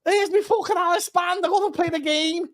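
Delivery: high, whiny send-up of a player; comic high-pitched player complaining; mock footballer. Acts out player wanting his headband.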